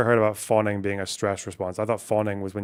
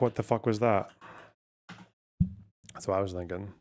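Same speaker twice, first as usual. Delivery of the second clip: ordinary talk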